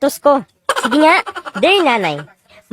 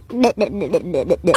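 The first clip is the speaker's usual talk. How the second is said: put-on low babbling baby sounds; baby babble; 8 semitones lower, 5 dB quieter than usual. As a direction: babble like a baby learning words. (daldal ng sanggol)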